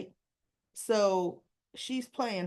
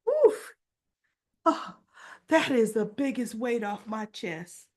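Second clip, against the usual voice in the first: relieved; 5 dB louder than usual. Retold or imitated. Wife after confessing to her husband, hugely relieved. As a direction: burst out with acted relief.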